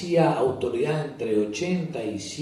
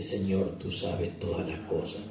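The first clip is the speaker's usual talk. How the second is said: soft and lower, head bowed; downcast quiet reply; 4 semitones lower, 7 dB quieter than usual. Acted. Peter, head bowed, answers Jesus softly. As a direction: say it with your head hung low.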